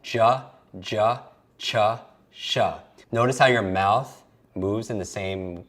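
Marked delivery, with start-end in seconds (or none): 0.0-2.6 "J, J, ch, sh" as juh, juh, chuh, shuh; sound-alike demo. Shown as a demonstration. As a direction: sound out "J, J, ch, sh" one by one with uh. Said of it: "Notice how they sound alike".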